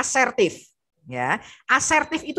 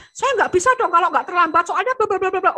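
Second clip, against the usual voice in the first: mock stubborn excuse; 8 semitones higher, faster than usual. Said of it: "Mungkin dia ngeyel loh".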